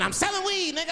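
put-on squeaky tiny-kid voice, matter-of-fact; baby voice selling weed; high baby voice. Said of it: "The baby said".

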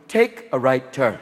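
slow, low robotic sat-nav voice; GPS voice. Retold or imitated. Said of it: "the car went".

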